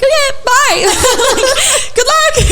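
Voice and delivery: chirpy hang-up, glad to be done; laughing relief. Retold or imitated.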